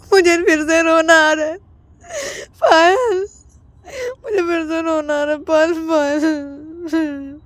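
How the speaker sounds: high whimpering sobbing plea; fake crying. Ananya crying to stop Payal leaving, high sobs. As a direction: cry out her name, drawn out.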